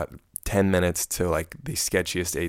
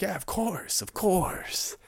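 high, eager yes-of-course; eager agreement; 12 semitones higher, slower than usual. Retold or imitated. Friends eagerly accepting the waiter's offer, high and eager. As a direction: jump in eagerly, pitched way up. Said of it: "the people I'm with are like".

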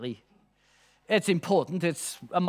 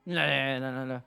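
low run of mock refusals; dismissive refusal; 6 semitones lower, 5 dB quieter than usual. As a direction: imitate a lecturer waving off a wrong answer. Lecturer rejecting each student answer.

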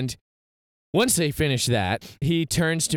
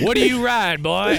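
put-on raised, louder country-accented demand; thick country accent; 7 semitones higher, 5 dB louder than usual. Bar owner repeating himself over live music, thick country accent. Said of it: "This guy has a thick country accent".

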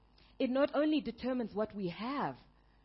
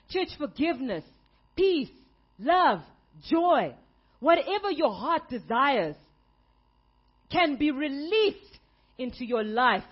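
preaching; 3 semitones higher, 6 dB louder than usual. Acted. Pastor to the church, building up a preaching list. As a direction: build each word to the church.

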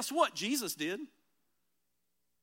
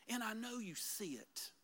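whisper; 14 dB quieter than usual. Acted. David's prayer voiced.

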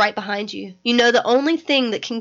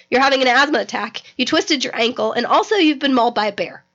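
ordinary talk, nothing marked

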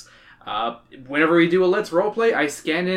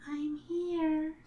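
put-on high, soft eerie little girl; a ghostly child close by in the dark; creepy child voice; 13 semitones higher, 9 dB quieter than usual. A narrator's reading. Ghost child Katie speaks.

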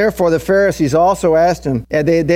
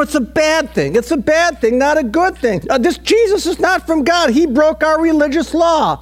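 mock Pharisee outrage; 10 semitones higher than usual. Voicing Pharisees' outrage, high pitch.

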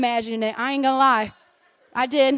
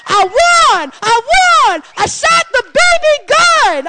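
much higher, louder, shouting joy; joyful giver; 11 semitones higher, 6 dB louder, slower than usual. Pretend. Churchgoer bringing the offering, shouting with joy. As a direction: shout it like you can't wait to give. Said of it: "we should be like".

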